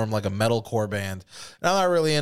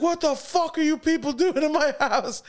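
high-pitched mock yell; imitated angry dad. Dad to his son and friend wrestling, mock outraged yell.

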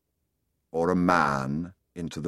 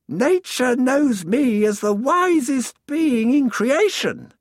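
slow, proud sun, much higher and louder; the sun boasting, high and slow; warm beaming sun voice; 6 dB louder, slower than usual. Acted. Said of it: "the sun said".

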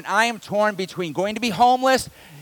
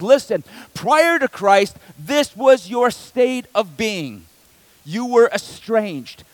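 slow emphatic preaching; slower than usual. Acted. Quoting Paul in sermon.

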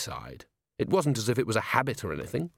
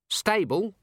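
put-on young dwarf voice; 9 semitones higher than usual. A narrator's reading. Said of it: "said Littlebottom".

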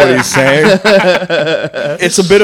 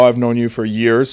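ordinary delivery

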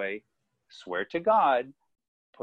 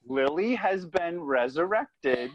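mock-religious proclamation; 5 semitones higher, 5 dB louder than usual. Boy proclaims lizard's miracle to the ceiling.

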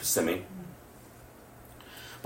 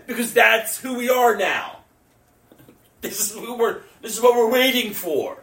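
high, raised preaching voice; impassioned preaching; 14 semitones higher, 8 dB louder than usual. Real, not acted. Preacher proclaiming good news to the church, impassioned.

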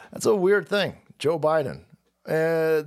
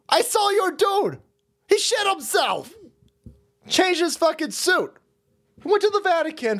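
ranting yell; 9 semitones higher than usual. Acted. Ranting at the staff.

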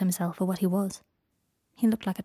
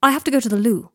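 loud, higher-pitched angry outburst; 4 semitones higher, 9 dB louder than usual. A narrator's reading. Draco excusing himself to Harry, angrily. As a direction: burst it out angrily.

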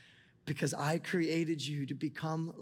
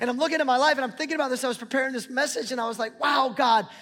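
much higher and louder, near shouting; full-force preaching; 8 semitones higher, 9 dB louder than usual. Acted. Pastor telling the church God is still changing him, loud fervent preaching. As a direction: let the sermon climax loudly.

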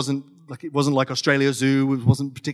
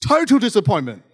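TOTAL hit high and loud, falling through DISAPPOINTMENT; loud, high stress on "Total"; emphatic letdown; 6 semitones higher, 5 dB louder than usual. Real, not acted. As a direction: punch "Total", then let the pitch drop.

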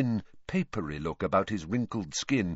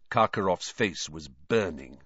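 usual speaking voice

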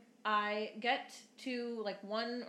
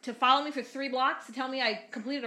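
loud, incredulous complaint; retold outrage, pushed out loudly; indignant protest; 2 semitones higher, 7 dB louder than usual. Driver challenging cop over rolling stop, indignant and loud.

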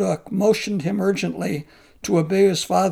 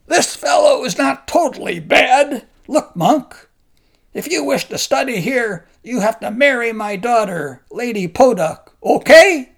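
shouting, high and loud, like a demon; booming command; 5 semitones higher, 8 dB louder than usual. Acted. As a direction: bark the order at the monk. Giant orders the monk to marry.

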